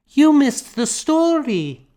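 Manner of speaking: put-on bright child's teasing. A narrator's reading. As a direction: tease like a small child. Child Emer voiced.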